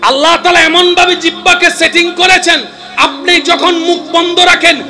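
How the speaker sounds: high, loud sermon voice, building to a shouted সুবহানাল্লাহ; impassioned preacher; full-force preaching. Acted. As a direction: preach it out to the whole crowd.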